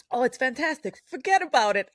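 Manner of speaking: lightly voiced waiter talking up a dish; waiter's voice. Waiter raves about the pork chop, lightly imitated, emphatic. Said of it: "the waiter tells him".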